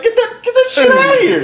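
squeaky high, laughing through the words; laughing mock outrage.

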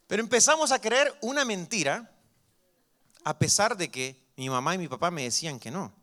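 ordinary delivery

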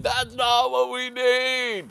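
high, slow, outraged cry; indignant outburst. Retold or imitated. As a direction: declare it like a wounded protester. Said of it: "And I'm like".

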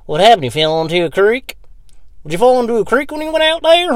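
hammed-up incredulous onlookers; mock shocked laundromat people. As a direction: people gawking at drenched Joey. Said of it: "back into the laundromat completely fucking drenched".

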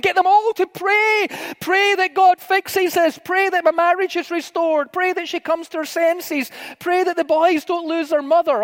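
high, urgent pleading; prayer panic. Retold or imitated. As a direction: plead in a retold panic, pitch held high. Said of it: "one of these prayer panics".

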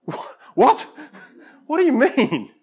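high, loud shocked "what?"; imitating a friend's astonished outburst; stunned disbelief. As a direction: blurt it out, caught completely off guard. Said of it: "And Josh is like".

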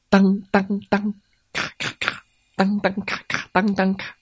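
high, clipped clank-clank, then crack-crack half unvoiced; clanking tooth-pulling noises. Fox pulling out all the tiger's teeth, mimicked clank and crack. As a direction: imitate the pliers yanking teeth out. Sound of teeth being pulled.

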